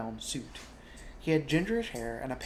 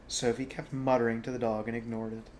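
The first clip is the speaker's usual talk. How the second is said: ordinary delivery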